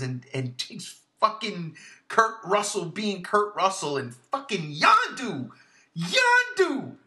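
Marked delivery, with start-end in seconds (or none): 6.0-7.0 a high, loud cry of the name; excited exclamation. Real, not acted. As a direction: call the name out with sudden delight. Exclaims favourite character's name.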